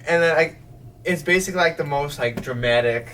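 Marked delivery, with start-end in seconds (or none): none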